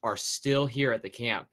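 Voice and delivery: story narration. Acted. Tells the pirate crew backstory.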